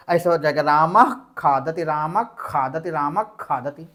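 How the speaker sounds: रामः खादति said "raamakh khaadati" with a half ख; teacher demo of रामः खादति; demonstrating sandhi pronunciation. Shown as a demonstration. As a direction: fold the visarga of रामः into a half ख. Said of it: "आधे खा के रूप में पढ़ेंगे".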